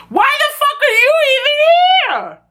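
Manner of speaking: very high, indignant squeal; exasperated outburst. Real, not acted. Fan scolds Eli for popping out of bushes, squealed exasperation. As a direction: squeal it out in disbelief. Yells at creepy character who pops up.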